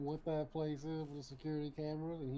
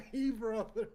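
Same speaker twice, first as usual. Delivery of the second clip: meme voice; 7 semitones higher, 9 dB louder than usual. Retold or imitated. Repeating meme line.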